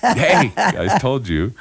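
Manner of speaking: a bright laughing hey. Real, not acted. Host laughs as her guest nearly cries. Laughing at the near-tears moment.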